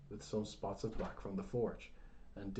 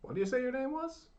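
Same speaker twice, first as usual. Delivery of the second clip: put-on high, loud old man's half shout; half shout it across the table; 7 dB louder, faster than usual. A narrator's reading. Deaf old man half shouting at Jared. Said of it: "Talton half shouted to him".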